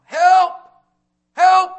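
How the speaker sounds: high, loud cry for help; yelling for help. Retold or imitated. Drowning swimmer calling to the boat, loud high yell. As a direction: shout it like you're drowning. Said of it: "you start yelling out".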